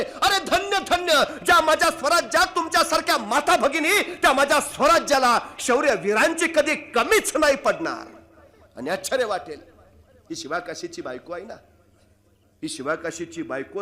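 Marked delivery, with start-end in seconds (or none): 0.0-8.2 much higher, louder ringing declaration; proud proclamation. Acted. King standing to hail the brave women, loud ringing proclamation. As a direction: proclaim it to the whole kingdom. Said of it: "उभं राहिले राजे".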